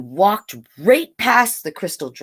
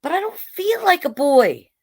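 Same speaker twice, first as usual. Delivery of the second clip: much higher, pleading little voice; child pleading with mother; 10 semitones higher than usual. Acted. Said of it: "she said".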